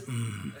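soft low mm like tasting soup; savoring hum. Retold or imitated. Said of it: "it always comes out as".

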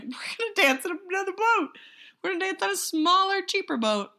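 mock character voice. Mocking characters' plan.

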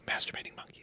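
breathless hushed whisper. Pretend. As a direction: whisper it like a guilty secret. Mock secret urge whispered.

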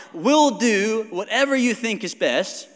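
slow, high, loud soldiers' reply; Saul's men agreeing. Retold or imitated. Soldiers answering their king, slow and loud. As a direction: answer the king slowly and eagerly. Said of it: "His men replied".

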